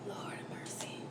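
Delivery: barely audible whisper; hushed, voiceless little plea; whispered aside. Real, not acted. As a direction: whisper it under your breath. Weary aside amid retelling.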